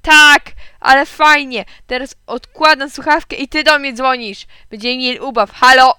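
loud, high, gleeful phone yelling; shouting. A narrator's reading. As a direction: shout it down the line, delighted. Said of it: "krzyknął Alcest". (radosne krzyki do telefonu)

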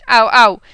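loud yelps of "au, au"; mock cry of pain. A narrator's reading. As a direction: yelp after banging the knee. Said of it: "znowu zaczął wołać".

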